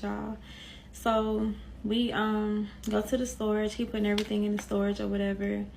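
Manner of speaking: ordinary talk, nothing marked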